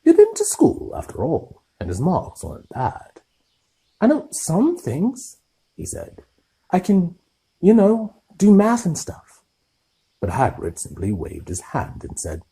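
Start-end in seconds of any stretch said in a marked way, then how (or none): 4.0-9.4 high, loud protest; boy defending himself to the giant; defensive boy. Acted. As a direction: insist a little sulkily. Harry defensive.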